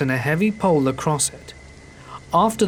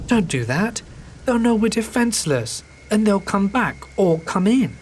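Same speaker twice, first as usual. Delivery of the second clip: panicked; 8 semitones higher than usual. Retold or imitated. Panicked plea to mum.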